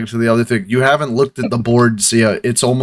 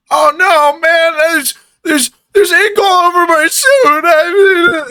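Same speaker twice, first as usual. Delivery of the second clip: much higher, louder panicked whine; whiny clerk; 4 dB louder than usual. Acted. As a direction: wail about the ruined suit. Clerk slams head, ink on suit.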